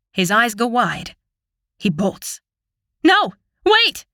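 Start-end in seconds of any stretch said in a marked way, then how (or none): 3.0-4.1 high, loud cry after him; desperate shout. A narrator's reading. Sister shouting after her fleeing brother. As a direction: yell for him to stop.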